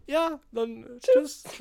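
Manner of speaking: high, very drawn-out farewell; awkward goodbye. Retold or imitated. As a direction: stretch out a sheepish, hesitant goodbye. Awkward goodbye when handing over key.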